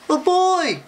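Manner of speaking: very high, loud frightened cry; scared exclamation. Acted. Scared bear sees Eddie, exclaiming in fright. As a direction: exclaim like a startled storybook bear. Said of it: "He's scared".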